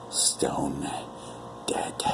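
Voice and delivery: stone dead, whispered low and soft; a chilling low whisper. A narrator's reading. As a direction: breathe it out, low and cold. Dark confirmation of death.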